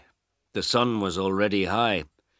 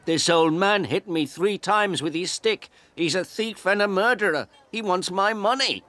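high, louder accusing shout; 11 semitones higher, 4 dB louder than usual. A narrator's reading. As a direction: yell the accusation at the officer. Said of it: "The photographer shouted to the policeman".

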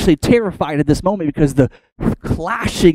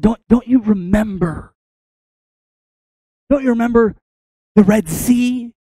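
raised, insistent appeal climbing higher; urgent reminding; 8 semitones higher, 4 dB louder than usual. Acted. Moses imploring everyone around him to remember, urgent rising appeal. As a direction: act out imploring them, building to a high finish.

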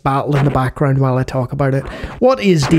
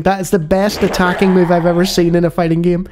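ordinary delivery

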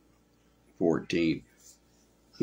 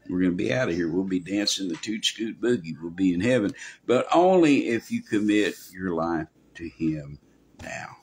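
usual speaking voice

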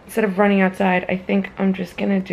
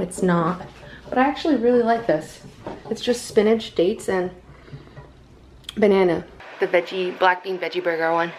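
usual speaking voice

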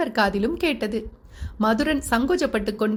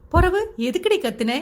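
mild, higher-pitched telling-off; hushed scolding; 7 semitones higher than usual. A narrator's reading. Woman scolds the bride for screaming, higher-pitched mild reprimand. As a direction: press the question as a restrained rebuke. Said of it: "மெல்லிய குரலில் அதட்ட".

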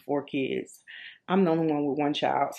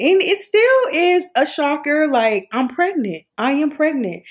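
shocked excitement; 9 semitones higher, 8 dB louder than usual. Real, not acted. Mom-to-be telling viewers she's pregnant, thrilled and amazed.

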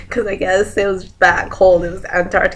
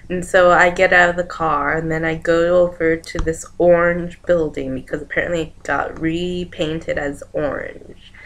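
ordinary talk, nothing marked

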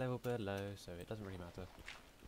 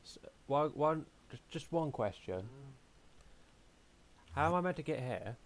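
calling out; 3 semitones higher, 6 dB louder than usual. Real, not acted. Calling out to Chloe.

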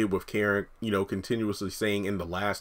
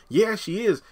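higher, quick reply as Pam; 12 semitones higher, 3 dB louder than usual. Retold or imitated. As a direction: answer as the nosy friend. Pam voiced in retold scene.

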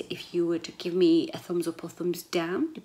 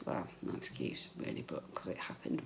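muttered aside; 11 dB quieter than usual. Real, not acted.

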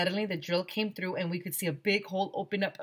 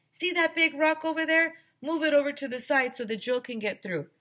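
high, louder calling voice; Mister Harrison calling down; 10 semitones higher, 4 dB louder than usual. Acted. Mister Harrison calling orders down to the kids, high and loud. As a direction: call the order down the hole.

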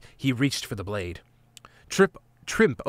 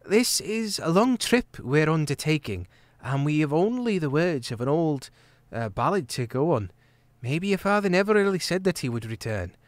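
put-on lightly higher, earnest young girl voice; young hedgehog maid; 4 semitones higher than usual. Trimp warning Martin gently, earnest young voice. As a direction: voice Trimp gently worried for her friend.

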